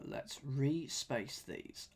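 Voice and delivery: ordinary talk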